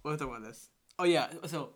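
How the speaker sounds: normal talking voice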